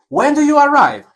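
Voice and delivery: high clear model question, stress on arrive; teacher model question. Shown as a demonstration. Teacher reading the example to students, high and clear. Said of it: "teacher repeats model question".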